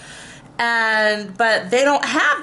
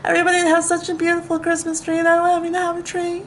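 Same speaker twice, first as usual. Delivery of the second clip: higher whiny fake-sob voice, hammed up; mock crying like Trisha Paytas; 6 semitones higher, faster than usual. Sulking about everyone else's Christmas trees, in a mock crying voice. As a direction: pretend to wail about having no tree. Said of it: "you know who I sound like? Trisha Paytas, like, when she cries".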